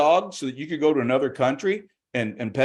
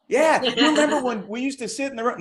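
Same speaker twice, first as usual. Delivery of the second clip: dog voice; 10 semitones higher than usual. Acted. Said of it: "It's like".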